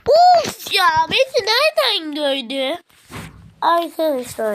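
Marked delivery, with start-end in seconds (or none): 0.1-2.8 high, loud exasperated groan; frustrated outcry. Real, not acted. Gamer spotted mid-match, loud frustrated cry. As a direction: cry out as the enemy spots you.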